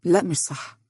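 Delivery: calm, sure denial; understated confidence; confident. A narrator's reading. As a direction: deny it with quiet assurance. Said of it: "وقال بثقة".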